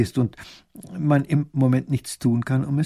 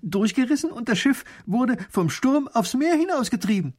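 worried servant; 10 semitones higher than usual. Acted. Servant reports ship lost.

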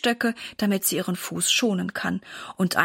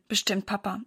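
ordinary delivery, nothing marked

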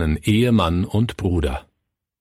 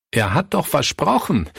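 higher, anguished outcry; crying out; 7 semitones higher than usual. A narrator's reading. Pastor Fritz cries out over a broken promise, pitched up. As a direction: call out in disbelief. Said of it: "rief Pastor Fritz".

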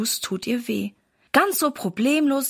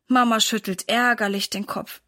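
ordinary delivery, nothing marked

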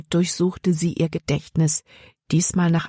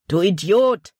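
mildly raised, scolding outcry; exclaimed insult; 3 semitones higher, 4 dB louder than usual. A narrator's reading. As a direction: exclaim it at a foolish partner. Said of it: "rief der andere Bandit aus". (empört ausgerufen)

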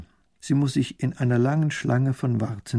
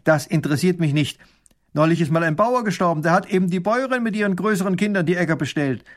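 louder, brisk dismissal; stern; 6 semitones higher, 4 dB louder, faster than usual. A narrator's reading. Minister rejects the widow's plea, sternly. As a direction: brush the plea aside severely. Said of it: "antwortet streng".

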